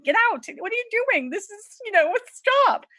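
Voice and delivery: high, panicky squeal shooing him out; alarmed shrieking bride. Acted. Bride shoos groom away before the wedding, high panicky shriek. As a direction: shriek at him to get out before he sees you.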